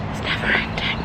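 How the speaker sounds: breathless whisper aside; hushed weary aside. Real, not acted. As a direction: whisper it to yourself, fed up. Muttered aside on the hill walk.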